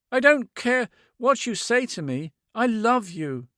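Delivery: high, loud, tearful insistence; cling to him with every word; desperate pleading. A narrator's reading. Woman insisting on her love to the man, high and pleading.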